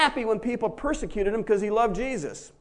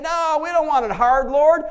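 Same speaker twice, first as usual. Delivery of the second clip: high, loud, pleading complaint to the Lord; mock whining petition; whiny prayer; 6 semitones higher, 5 dB louder than usual. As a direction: beg like a spoiled child. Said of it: "We say".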